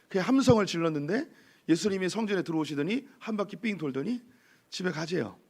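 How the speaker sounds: ordinary talk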